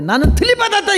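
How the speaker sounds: high, loud mock boast. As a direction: show off like a proud show-off. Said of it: "얼마나 꼴값을 당해".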